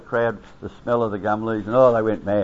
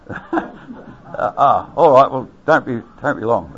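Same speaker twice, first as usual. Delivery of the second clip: words broken by laughter, louder; laughing reply; 4 semitones higher, 7 dB louder, slower than usual. Retold or imitated. Officer told the stove is for breakfast, laughing it off. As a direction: chuckle through a flustered okay.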